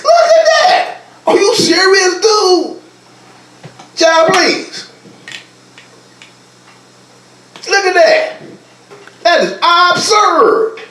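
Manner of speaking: high, disbelieving outcry; incredulous. Real, not acted. Preacher showing the congregation arrest rates, high incredulous outcry. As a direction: cry out at how absurd it is.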